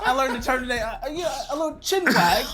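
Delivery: high, hammed-up British accent through laughter. Shown as a demonstration. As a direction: put on a silly British voice. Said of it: "give us your best British accent".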